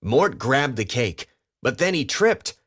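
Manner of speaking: ordinary delivery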